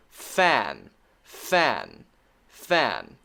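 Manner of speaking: fan with short a, said slowly; example word fan. Shown as a demonstration. Teacher giving fan as short a example. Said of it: "so an example".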